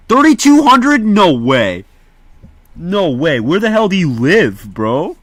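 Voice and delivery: loud, high-pitched incredulous outburst; real disbelief. Real, not acted. Streamer reacting to a viewer's tiny town population, loudly incredulous. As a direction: exclaim in astonishment, voice leaping way up.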